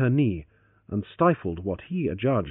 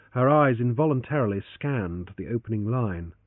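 usual speaking voice

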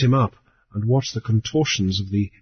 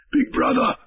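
high, breathless call; out of breath from running; high, gasping greeting; panting; 13 semitones higher than usual. A narrator's reading. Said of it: "the goat man panted".

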